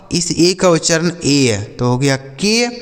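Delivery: ordinary talk, nothing marked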